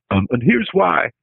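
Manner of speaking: a quick stab at Bill Clinton; lightly voiced Clinton, barely an accent; Clinton impersonation. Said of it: "which is my Clinton impersonation".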